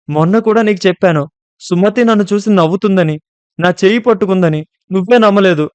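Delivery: put-on frightened girl yelling at her friend; angry shout. A narrator's reading. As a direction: hurl it at her in anger. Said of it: "కోపంగా గట్టిగా అరుస్తూ".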